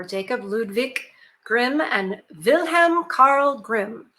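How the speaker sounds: slow, careful stab at German names in a German accent; a clumsy German accent on each name. Shown as a demonstration. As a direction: pronounce the Grimm names the German way, slowly. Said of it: "I apologize for my German accent".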